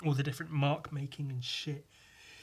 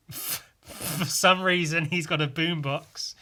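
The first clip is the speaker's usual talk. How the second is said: ordinary talk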